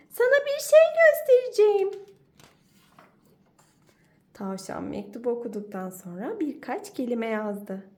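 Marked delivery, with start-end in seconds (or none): 0.1-1.9 high, loud eager badger; can't wait to show it; excited badger. Badger excitedly calls rabbit.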